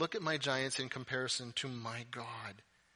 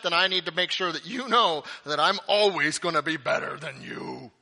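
loud, high, hammed-up bragging; bossy older sibling; 7 semitones higher, 11 dB louder than usual. Older sibling refusing to let the younger shine, superior. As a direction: lord it over a younger sibling.

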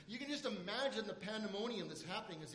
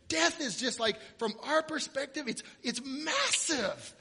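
much louder, higher, slowed, hammering preacher cadence; preaching at full force; 6 semitones higher, 13 dB louder than usual. Acted. Pastor to his congregation about death, preaching hard. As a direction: drive it home from the pulpit.

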